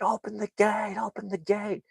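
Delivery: a father at the gate calling out high; high urgent call. Retold or imitated. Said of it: "the father's like".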